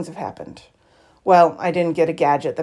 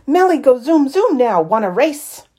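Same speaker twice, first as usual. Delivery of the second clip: excited friend; 7 semitones higher, 5 dB louder than usual. A narrator's reading.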